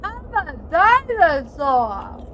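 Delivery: mock dinosaur shout. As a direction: announce it like a proud dinosaur. Player yelling in-game dinosaur line.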